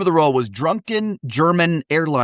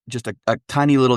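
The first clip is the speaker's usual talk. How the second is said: normal talking voice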